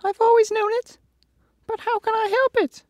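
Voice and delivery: a big coward admitting it; high squeaky lion in shame; ashamed lion. A narrator's reading. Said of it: "hanging his head in shame".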